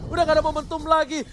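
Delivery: usual speaking voice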